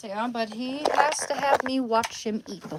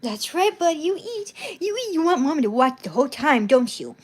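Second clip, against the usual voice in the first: much higher, louder pet talk; doting dog talk; 7 semitones higher, 8 dB louder than usual. Real, not acted. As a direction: gush over the dog as he eats. Mom cheering dog while eating.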